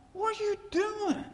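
high, startled "what are you doing"; incredulous thought. Retold or imitated. Pastor recalling his shock at a visiting preacher, incredulous. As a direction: blurt it out in disbelief.

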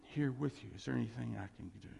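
soft, gentle consolation; low, tender reassurance; comforting. Shown as a demonstration. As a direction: console someone who is grieving, quietly. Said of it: "See, that's comforting".

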